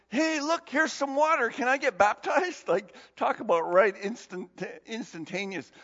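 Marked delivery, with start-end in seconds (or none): 0.1-2.7 eager eunuch. Retold or imitated. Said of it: "the eunuch says to him".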